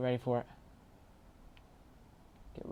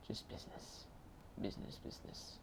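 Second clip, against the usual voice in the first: whisper; 5 semitones lower, 14 dB quieter than usual. Pretend. Muttering a game line.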